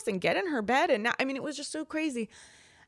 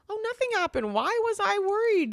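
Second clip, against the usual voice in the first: high-pitched self-talk, brushing the worry away; retold relief; 11 semitones higher, 4 dB louder than usual. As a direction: act out sudden relief in a much higher voice.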